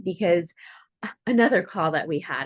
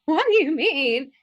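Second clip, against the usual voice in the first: a high, loud what, then a slower incredulous question; shocked disbelief; 8 semitones higher, 5 dB louder, slower than usual. Retold or imitated. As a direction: replay your stunned past self. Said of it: "And I was like".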